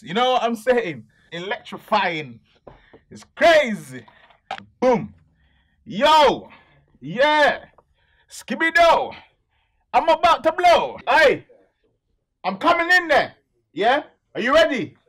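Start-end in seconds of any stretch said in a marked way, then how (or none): 3.1-15.0 high, loud hype yells; hype shouting. Acted. Rapper bursts into the studio, loud and hyped. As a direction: pump up the crowd, shouting.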